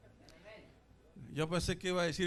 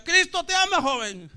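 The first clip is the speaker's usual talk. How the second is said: high, loud imitated proclamation; evangelist calling out; 9 semitones higher, 9 dB louder than usual. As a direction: call to a youth like a street preacher. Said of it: "alguien me decía".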